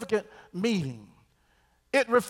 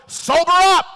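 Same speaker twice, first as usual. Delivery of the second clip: loud call; 8 semitones higher, 8 dB louder than usual. Acted. Preacher calls out to congregation.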